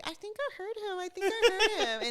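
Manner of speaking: high, laughing mock of herself; mock eager, laughing. Mocking her daily hamster claims, high and laughing. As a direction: laugh through her own old hopeful claim.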